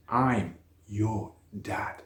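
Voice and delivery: slow, soft and tender; tender reveal. A narrator's reading. Father telling his son he is his dad, slow and tender. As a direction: let it land gently, very slowly.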